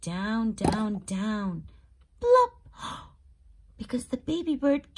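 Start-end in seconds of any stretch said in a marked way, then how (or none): 2.2-2.5 high, loud cartoon plop; plop sound effect. Narrator voices the bird landing, high loud plop. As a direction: pop it out like a fall. Baby bird falls from nest.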